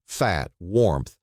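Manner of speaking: usual speaking voice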